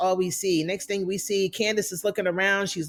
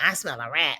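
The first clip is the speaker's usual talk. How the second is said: voiced lower than her own talk, a little suspicious; lady shooter voice; 5 semitones lower than usual. Recapping the show, she voices the lady shooter's lower, suspicious line. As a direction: play the lady shooter sniffing out a snitch. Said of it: "Lady shooter talking about".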